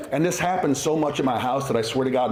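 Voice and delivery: ordinary talk, nothing marked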